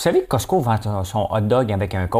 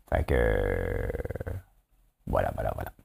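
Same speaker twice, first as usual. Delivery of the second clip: deep mock voice; 13 semitones lower, 8 dB quieter than usual. Sings voilà in deep voice.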